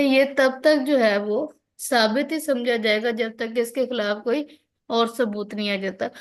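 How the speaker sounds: ordinary talk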